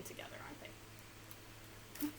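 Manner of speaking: soft whisper. Real, not acted. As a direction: lean in and whisper it. Aside to children.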